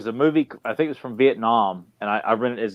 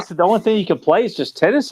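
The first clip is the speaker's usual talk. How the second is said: loud, high frustrated whine; exasperated complaint; 7 semitones higher, 6 dB louder than usual. Retold or imitated. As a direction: complain loudly about only tennis.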